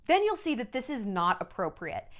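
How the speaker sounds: normal talking voice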